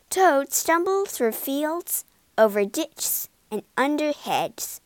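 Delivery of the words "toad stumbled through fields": ordinary delivery, nothing marked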